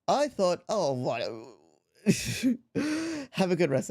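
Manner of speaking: ordinary talk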